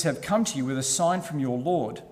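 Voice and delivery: usual speaking voice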